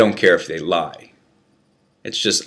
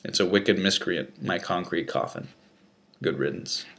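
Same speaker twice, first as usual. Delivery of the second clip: quiet farewell; 8 dB quieter than usual. Acted. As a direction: mutter a farewell to the cell. Farewell to his cell.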